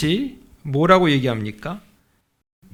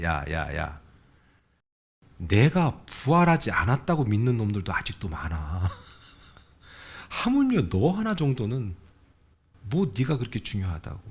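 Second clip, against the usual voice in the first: low, mock-stern voice of God chiding; deep and dry, like God teasing a sulking child; a low, amused divine rebuke; God's voice, low; 7 semitones lower, 4 dB quieter than usual. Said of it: "하나님이 제게 응답을 어떻게 주셨냐면".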